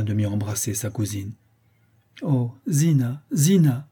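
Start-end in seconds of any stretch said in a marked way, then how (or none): 2.2-3.9 raised, drawn-out cry of the name; emotional exclamation. A narrator's reading. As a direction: call to her, overcome with feeling. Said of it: "s'écria Vasilissa, qui fondit en larmes". (exclamation émue, voix plus haute)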